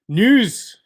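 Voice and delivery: "news" announced high and loud, falling; news announcer. Pretend. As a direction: boom out "news" like a segment jingle.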